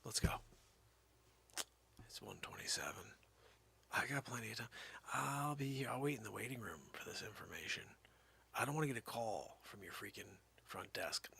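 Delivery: low whisper; murmured aside; hushed. Real, not acted. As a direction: keep it breathy and secret.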